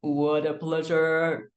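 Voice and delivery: drawn out, relishing the win; relishing beating a ten-year player; slow savoring. Retold or imitated. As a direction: savor every word, slowly. Savouring repeated, slow.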